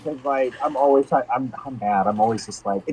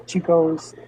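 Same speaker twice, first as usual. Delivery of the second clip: normal talking voice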